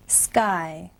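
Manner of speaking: ordinary delivery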